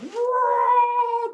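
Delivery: high, loud drawn-out squawk; rooster squawk. Acted. Rooster tossed towards the fire, squawking loudly. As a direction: cry out like a flung rooster.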